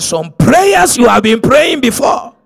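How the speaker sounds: loud, slow proclamation; the pastor declaring her prayers saved her children; forceful declaration. Acted. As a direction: preach it out with force. Emphatic pronouncement to woman.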